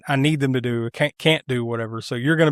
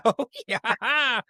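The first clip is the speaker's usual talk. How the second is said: higher, gleeful mock madman; mock maniacal glee; 8 semitones higher, 5 dB quieter than usual. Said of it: "just a maniacal laugh".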